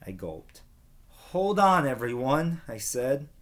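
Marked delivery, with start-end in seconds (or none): 1.1-2.6 calling out. Acted. Will calls out to the crowd.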